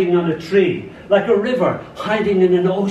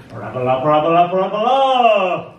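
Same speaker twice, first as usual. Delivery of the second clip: hollering; 2 semitones higher, 5 dB louder than usual. A narrator's reading. Ball hollering as it bounces off the wall, building loud. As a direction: holler it out like a ball in mid-flight. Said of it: "hollers the ball".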